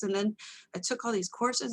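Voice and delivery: ordinary talk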